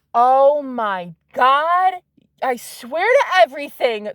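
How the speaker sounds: amazed outburst; real astonishment. Real, not acted. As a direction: stretch it out, stunned by what just turned up. Stunned by the card drawn.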